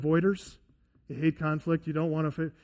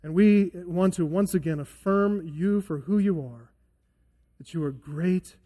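closing prayer. Acted. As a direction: pray earnestly, hushed. Pastor leads closing prayer.

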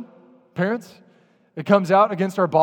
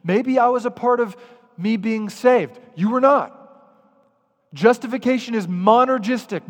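ordinary talk

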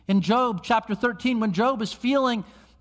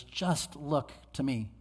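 soft, slow, lower voice; gentle divine appeal; 4 semitones lower, 9 dB quieter than usual. Acted. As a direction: say it low and unhurried, as God's quiet invitation. Voicing God's quiet appeal.

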